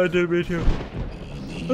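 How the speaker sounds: through a yawn; stretched yawning words. Real, not acted. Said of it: "yawning there".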